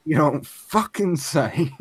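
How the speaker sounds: high, loud, drawn-out sarcasm; sarcastic mock amazement. Player reacting to the obvious explanation, sarcastic mock amazement. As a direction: react with hammed-up fake astonishment. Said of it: "And you're like".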